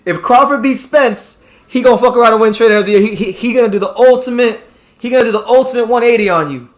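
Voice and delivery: high, loud and worked up at the chatter; heated. Real, not acted. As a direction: press the point hard at Hyro.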